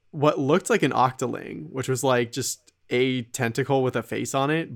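usual speaking voice

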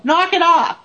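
high mock yell; retold yell. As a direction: call it out high, imitating a firm order. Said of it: "close your eyes and yell".

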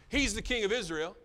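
high, loud jeering taunt; a preacher voicing the crowd's scornful jeer; mocking shout. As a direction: hurl it as scorn.

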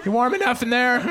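high-pitched motherly call; hammed-up mom hollering a check-in; fussing mom. Mom popping up over a snowbank, fussing. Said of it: "my mom would just pop up".

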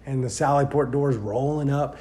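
ordinary talk

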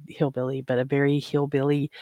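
usual speaking voice

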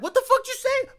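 loud, very high mock confrontation; high-pitched, hammed-up outrage; acted challenge. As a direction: act out squaring up for a fight. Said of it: "He's like".

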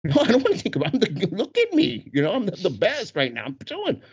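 high-pitched, hammed-up refusal; mock-indignant protest, pitch jumping up; aggravated protest. Husband refusing his wife's break from dancing, comically aggravated. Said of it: "so I'm getting aggravated".

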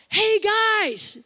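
loud high shout to get their attention; yelling to the boys. Retold or imitated. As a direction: holler across the intersection at the teens. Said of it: "They thought I was yelling at 'em".

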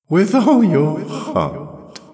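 high, loud, slow repetition with mirth underneath; mocking echo. A narrator's reading. The voice echoes Jirel's vow, mocking. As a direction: throw the words back, ringing and derisive. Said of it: "echoed the voice, and there was an undernote of laughter".

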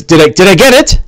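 a prim fellow worried about his face; Bing fussing. Acted. GM voices Bing.

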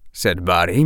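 plain reading voice

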